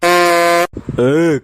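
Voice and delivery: loud, rough shout of "ए"; loud call. Acted. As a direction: bark it out to pick a fight. Bully Sethi calls out. (गुंडे की ज़ोरदार पुकार)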